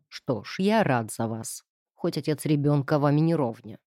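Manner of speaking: sneering false congratulations; mocking. A narrator's reading. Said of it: "Издевательски спросил он".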